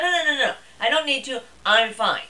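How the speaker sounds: high, loud, insistent brush-off; mock protest. Acts out refusing husband's advice to rest.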